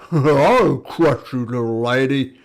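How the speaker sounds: loud, slow giant threat; threatening giant. Acted. Giant threatens the little lady who blasted him, slow roar. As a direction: boom it out slowly as a menacing giant.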